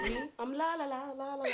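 high, tiny tinkling la-la voice; sing-song like a little fairy. Pretend. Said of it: "My fairy voice".

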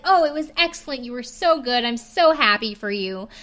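imitating fake gushing, high and loud; mock over-sweet praise, laid on thick. Ralph faking praise to Elsie, mock gushing. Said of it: "Ralph gets super fucking fake immediately".

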